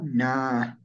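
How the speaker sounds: NA with the vowel held long; "Na" said as naa, double a; long open na. Shown as a demonstration. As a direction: draw out the a in "Na". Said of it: "pronunciamos así".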